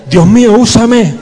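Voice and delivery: high, loud cry of Dios mío, úsame; full-voiced fervent shout; shouted prayer. Shown as a demonstration. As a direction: shout it out to God. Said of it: "Grita, grita, grita".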